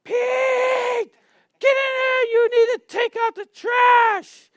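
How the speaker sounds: high, slow, piercing shout at her husband; yelling wife. Retold or imitated. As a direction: bellow it like a fed-up wife calling across the house. Wife orders husband to trash.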